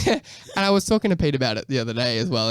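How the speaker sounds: ordinary delivery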